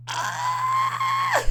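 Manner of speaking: long, very high cry; anguished squeal. Real, not acted. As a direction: let out a drawn-out high wail. Anguish at hearing gunshots.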